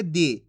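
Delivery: brief, low falling "दी"; "दी" kept short as a reading model; short i sound. Shown as a demonstration. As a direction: say "दी" with a short vowel, dropping in pitch. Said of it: "इसके उच्चारण में कम समय लगेगा".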